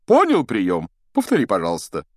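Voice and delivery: loud question dropping to normal pitch; breezy Lockhart. Acted. Teacher clapping a pupil on the shoulder, breezy prompt.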